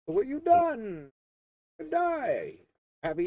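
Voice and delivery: high, slow taunting villain voice; Joker voice. Retold or imitated. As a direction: sneer it out like the Joker. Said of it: "It's like".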